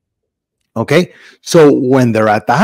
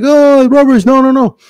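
high-pitched mock bawling; hammed-up toddler crying; imitated crying child; 10 semitones higher than usual. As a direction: wail like a small boy refusing, pitched way up. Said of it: "So he starts crying".

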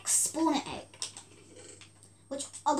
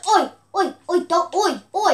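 shouting oi; 3 semitones higher, 6 dB louder than usual. Real, not acted. Yelling at attacking animal.

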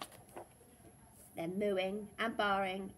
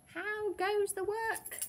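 high, drawn-out farmer's call; 11 semitones higher, slower than usual. A narrator's reading. Farmer calling to the working duck, high drawn-out call. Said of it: "called the farmer".

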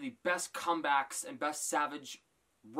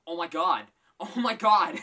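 loud, laughing oh my god; laughing exclamation; 3 semitones higher, 11 dB louder than usual. Real, not acted. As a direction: burst out laughing as you say it. Cracking up at the roast.

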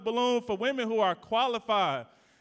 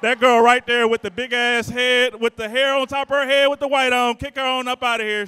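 loud hype-man call-out, teasing a guest; mock call-out; 4 semitones higher, 9 dB louder than usual.

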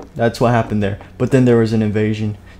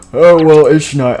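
pompous king, louder; 4 semitones higher, 7 dB louder than usual. Acted. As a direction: announce it like a king. King voiced going to sleep.

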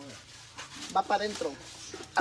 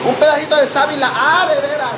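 loud over blender; 7 semitones higher, 8 dB louder than usual. Real, not acted. Talking over running blender.

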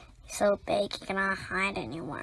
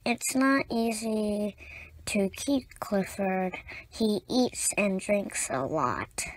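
usual speaking voice

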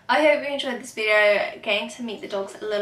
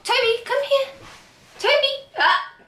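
much higher, louder call to Toby; high dog call; 6 dB louder than usual. Real, not acted. Calling Toby to jump up, high and loud.

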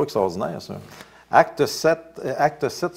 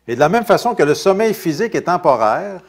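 fervent preaching; 8 semitones higher, 10 dB louder than usual. Acted. Preaching at full force.

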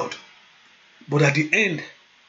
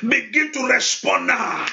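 fervent prayer; 6 semitones higher than usual. Acted. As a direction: declare it over the congregation. Fiery prayer session.

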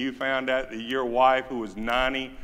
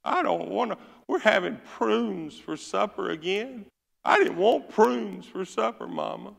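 high nasal-free whine, pitch raised; whining girl; 8 semitones higher than usual. Retold or imitated. Whiner family girl whines to Mama about prunes, raised pitch. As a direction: complain like a spoiled kid. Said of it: "family member girl said".

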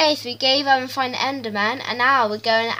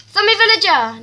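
excited shout; 9 semitones higher, 11 dB louder than usual. Real, not acted. Spots the mob it wanted.

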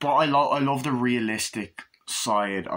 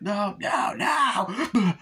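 very high mock cries of panic; panicked cries; 11 semitones higher, much slower than usual. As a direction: wail it as a victim in a nightmare. Victim crying out in nightmare.

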